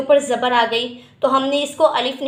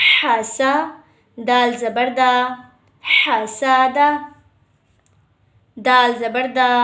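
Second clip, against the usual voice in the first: slow spelling drill; much slower than usual. Shown as a demonstration. Teacher spells letters aloud in drill.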